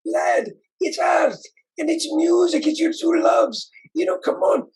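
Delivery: put-on a Scottish artist urging on with a hearty Scottish accent. Scot coaxing Jesse to make videos, in a high hearty Scottish accent. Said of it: "I can't do the accent well".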